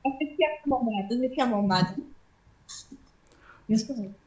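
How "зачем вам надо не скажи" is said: normal talking voice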